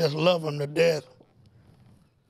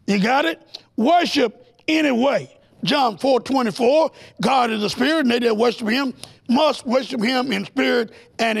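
preaching at full force, higher and driving; fiery preaching; 4 semitones higher, 3 dB louder than usual. Acted. Preacher warning the congregation against snake talk, fiery preaching. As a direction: preach it to the congregation, building fire.

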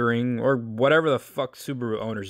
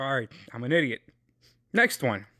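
usual speaking voice

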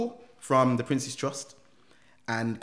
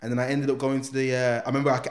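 ordinary talk, nothing marked

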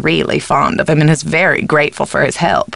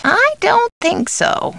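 very high, drawn-out doubt; emphatic doubt; slower than usual. Acted. Housekeeper doubting the caller, high and drawn out. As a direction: swoop up high and stretch it out. Alternate takes of stock line.

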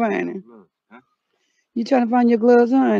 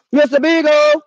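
louder, higher call; calling out the dog's name; 5 semitones higher, 4 dB louder than usual. Pretend. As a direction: shout it toward the trees. Calling the lost dog.

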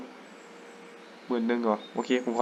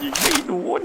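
laughing; 12 semitones higher than usual. Real, not acted. Misses the shot, laughs.

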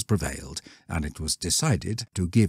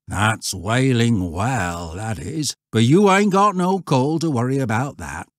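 countryman brushing the question off; old shepherd; 5 semitones higher, 5 dB louder than usual. Acted.

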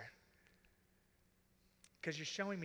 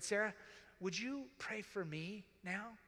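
gentle ask; 6 semitones higher than usual. Retold or imitated. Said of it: "and said".

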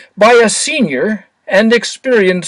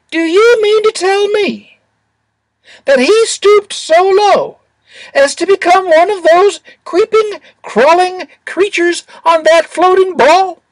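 high, disdainful little angel; disgusted; 10 semitones higher than usual. A narrator's reading. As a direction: sneer it out in a high voice. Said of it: "in that tone of voice".

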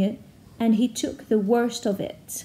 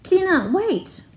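urgent call; 7 semitones higher, slower than usual. Acted. Calling to banshee.

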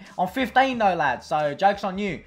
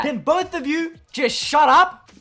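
higher, slow exasperated voice; annoyed third player; 7 semitones higher, slower than usual. Acted. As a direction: another player fed up with both. Third player snaps at both.

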